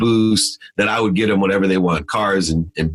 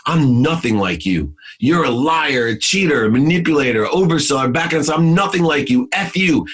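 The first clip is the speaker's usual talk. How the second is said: furious outburst; 7 semitones higher than usual. Retold or imitated. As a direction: spit the insults at him, building. Son tells father off on phone.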